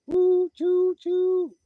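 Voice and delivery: high, loud hooted whistle; train whistle imitation. Imitating the train just heard, high loud hoot.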